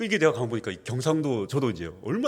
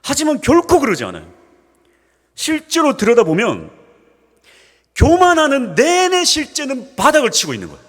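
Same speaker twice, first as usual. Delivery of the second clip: much higher, louder preacher's climax; full-force preaching; 11 dB louder than usual. Acted. Pastor rousing the congregation on humility, high and loud. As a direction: drive the point home with fire.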